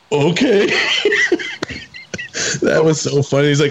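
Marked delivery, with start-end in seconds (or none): 0.1-0.7 laughing through the word. Retold or imitated. Guard reacts, laughing. 0.7-2.6 high laugh. Real, not acted. Laughing at the story.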